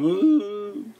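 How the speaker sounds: high, loud groan of exhaustion; tired groan. Retold or imitated. Lying in bed too worn out to eat, groaning. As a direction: groan like you can't get up. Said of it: "lying in bed going".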